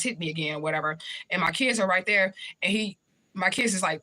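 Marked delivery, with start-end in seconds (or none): none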